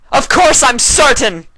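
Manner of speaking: loud, high shout of frustration; hollering. A narrator's reading. Luna hollering at her sister, high and loud. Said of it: "she hollered".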